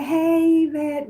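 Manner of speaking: high, spunky greeting. Retold or imitated. Medium voicing grandmother greeting her granddaughter, spunky. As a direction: call it out high and full of spunk. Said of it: "a real spunky type of energy".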